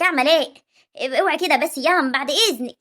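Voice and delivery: ordinary delivery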